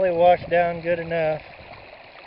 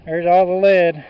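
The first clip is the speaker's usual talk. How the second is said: shouting over wind; 2 semitones higher, 11 dB louder than usual. Real, not acted.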